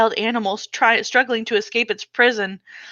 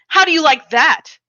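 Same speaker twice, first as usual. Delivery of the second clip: taunting; 7 semitones higher, 5 dB louder than usual. Acted. Father striking with stick.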